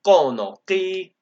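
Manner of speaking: "kono ki" with a steep fall on the first o, "ki" held high. Shown as a demonstration. Conlanger demonstrating the compound "kono ki", falling tone then high. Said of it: "with a falling tone on the first O".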